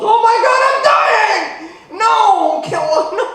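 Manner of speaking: sudden panicked high shout, cut by a laugh. Retold or imitated. Comic hearing cancer diagnosis, brain screams in high panic. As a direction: blurt it out like you think you're dying. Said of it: "your brain just goes".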